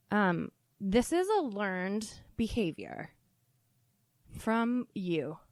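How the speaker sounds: ordinary talk, nothing marked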